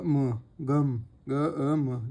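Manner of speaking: "ग, अ, म" split into separate sounds; sounding out letters. Shown as a demonstration. Teacher splitting गम into sounds for students. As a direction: break "गम" into "ग", "अ", "म" one by one. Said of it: "जी के बाद लगाएंगे क्योंकि ग".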